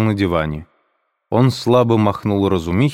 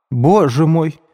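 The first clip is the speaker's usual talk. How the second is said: high, louder alarmed cry; exclaiming in alarm; 8 semitones higher, 4 dB louder, slower than usual. A narrator's reading. Мать в ужасе вскрикивает, громко и высоко. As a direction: cry out in shock at her son's words. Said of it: "вскричала Пульхерия Александровна".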